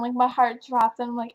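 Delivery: ordinary talk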